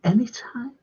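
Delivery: a soft breathy whisper of a come-on; whispered invite. Retold or imitated. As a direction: whisper it like a flirt. Barmaid voiced, whispered.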